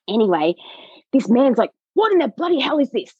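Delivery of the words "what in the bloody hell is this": high-pitched mock exasperation; imitating frustrated man. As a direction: act out his indignant, baffled complaint. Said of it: "He's getting so frustrated".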